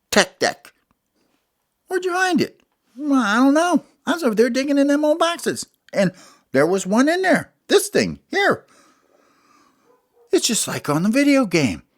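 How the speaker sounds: high, fast little critter voice; Smalls' voice. Acted. Smalls telling friends where he found the board. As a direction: chatter it out high and quick.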